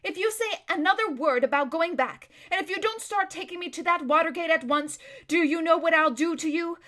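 merciless threat. A narrator's reading. Erebus threatening to scream unless led to the Watergate, loud and stern. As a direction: threaten her hard, with no let-up. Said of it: "no occasion for mercy".

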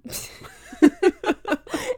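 high bursting laugh; real amused laugh. Real, not acted. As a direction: laugh out at the joke. Laughs at five-year plan.

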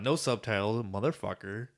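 ordinary talk, nothing marked

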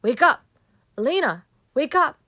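loud, high urgent yell. A narrator's reading. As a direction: yell to jolt a sleeper awake. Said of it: "and yells at me".

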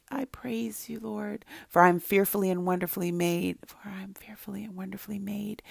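son's timid whisper of the verse. Retold or imitated. Boy reluctantly whispering the verse to his mother. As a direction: breathe the words, barely voiced. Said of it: "He was whispering it in the beginning".

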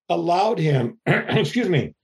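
ordinary talk, nothing marked

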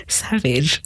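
high giggles around the word; real laughing. Real, not acted. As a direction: crack up mid-comment. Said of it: "I don't know why I'm laughing".

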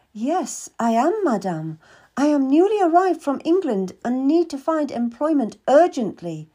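plain reading voice

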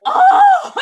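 very high, loud mock wail; mock crying. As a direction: wail as if bursting into happy tears. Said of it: "and I started crying".

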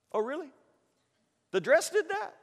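soft, high sceptical question; mock incredulous. Preacher answering the girl's excuse, incredulous. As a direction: doubt it quietly, pitch climbing.

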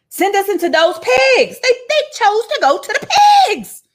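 high, loud, laughing demon plea; squeaky laughing demons. Acted. Demons begging to enter the pigs, high and laughing. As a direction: shout it high while cracking up.

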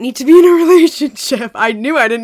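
words break into a laugh near the end; laughing through it. Retold or imitated. Past self laughing mid-sentence.

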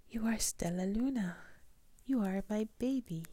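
high, breathy stage whisper; emotional whisper. A narrator's reading. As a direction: whisper it high with tender wonder. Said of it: "she whispered".

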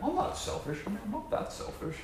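high, soft self-denying voice; mock denial. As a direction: squeak out a weak denial. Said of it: "And thinking, like".

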